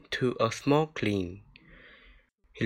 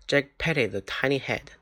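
plain reading voice

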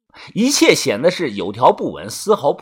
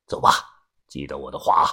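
put-on old man; 13 semitones lower, 5 dB quieter, much faster than usual. A narrator's reading. Grandpa dismisses the narrator, low old-man voice. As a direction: lower elder voice.

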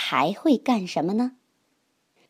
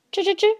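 mouse squeaking; 11 semitones higher, much faster than usual. Acted. Mouse answers the duck.

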